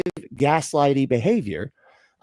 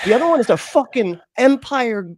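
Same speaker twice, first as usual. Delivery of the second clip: heated rant; 6 semitones higher than usual. Real, not acted.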